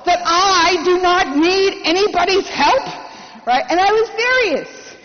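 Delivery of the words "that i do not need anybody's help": higher, louder, slow and indignant; slow, raised, insulted protest; angry retort. Retold or imitated. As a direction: spit it out, offended anyone would offer. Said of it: "I'm getting angry at this point".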